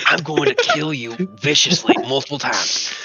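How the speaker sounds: low, deadpan threat; mock threat. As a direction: quietly promise murder in jest. Player threatens the whispering meddler.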